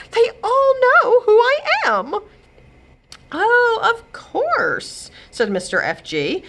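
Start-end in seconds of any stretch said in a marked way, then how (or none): 0.1-2.2 high, loud, near-crying wail; upset cry. Acted. Godmother upset, recognized on beach. 3.3-5.1 slow drawn-out reply, a hint of a husband; lightly voiced husband. Husband replies in character.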